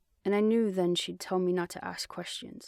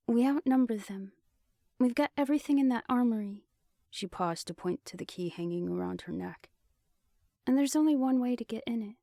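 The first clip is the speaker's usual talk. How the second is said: higher, brighter female character voice; Genevieve, confident and a touch higher; young woman voice; 5 semitones higher than usual. A narrator's reading. Genevieve voiced, skipping line 11.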